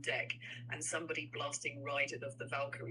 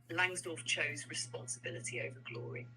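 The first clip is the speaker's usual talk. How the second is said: ordinary delivery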